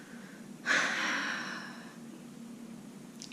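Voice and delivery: long breathy sigh; heavy sigh. Real, not acted. Vlogger after recounting dismissive remarks about depression, heavy sigh. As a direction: let out one long, slow exhale.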